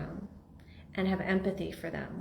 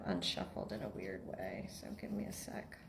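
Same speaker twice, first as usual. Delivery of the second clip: very quiet, near-whispered mumble to the room; under-the-breath aside; hushed aside; 11 semitones lower, 14 dB quieter than usual. Real, not acted. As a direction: drop almost to a whisper while busy. Aside while sorting the cards.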